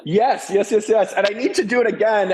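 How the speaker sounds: bursting to jump in with yes after yes; excited. Real, not acted. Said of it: "You got me excited".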